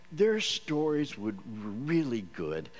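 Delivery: stretched slowly in awe; slow awestruck emphasis on their; awed slow emphasis. Real, not acted. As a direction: draw out their stories in wonder. Awed testimony envy.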